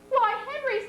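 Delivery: a startled mother's exclamation; surprised. A narrator's reading. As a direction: pitch jumps up high in surprise. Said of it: "She sounded surprised".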